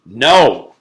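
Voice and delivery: sharp shouted refusal; girl shouting no at Paul; loud no. Acted. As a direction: bark it at him. Leslie refuses angrily.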